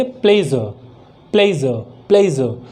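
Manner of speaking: प्लेजर drilled as "ple-zuh", each one falling; low, falling model of प्लेजर as "ple-zuh"; correct pronunciation. Shown as a demonstration. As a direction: repeat प्लेजर with z and silent r. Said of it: "क्या कहेंगे?".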